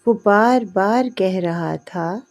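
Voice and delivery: usual speaking voice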